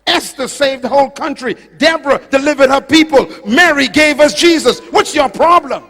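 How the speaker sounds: loud, high-pitched sermon volley; impassioned preaching. Real, not acted. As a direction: fire off each example at the congregation, loud and high. Preaches at full force.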